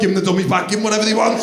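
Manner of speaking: desperate plea. Retold or imitated. Pleading to wife.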